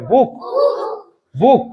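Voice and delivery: "বুক" with a short oo, said twice; short vowel. Shown as a demonstration. Teacher drilling pupils on short oo, "বুক" cut short. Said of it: "হস্যুকার".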